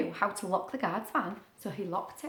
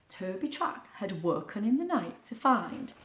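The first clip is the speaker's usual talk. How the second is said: ordinary delivery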